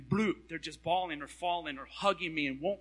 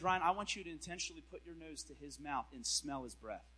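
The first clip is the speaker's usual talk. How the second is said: quiet voice of God; 2 semitones lower, 7 dB quieter than usual. Retold or imitated. God's voice quoted softly.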